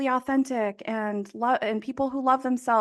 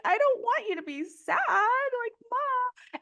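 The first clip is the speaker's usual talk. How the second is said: lightly voiced little boy, higher; a hint of a tearful small son; child's voice; 12 semitones higher than usual. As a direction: son tells his mom he doesn't want her sad. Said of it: "And he's like".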